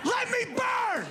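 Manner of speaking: high, slow shouted surrender; defeated husband. Retold or imitated. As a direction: wail it out, giving up. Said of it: "And he's like".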